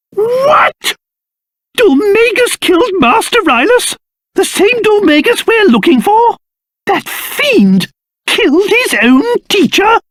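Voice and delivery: high-pitched theatrical disbelief; shocked outrage. Acted. King learns that fiend killed his own teacher, aghast. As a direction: exclaim it, aghast at the news. Learns a master was murdered.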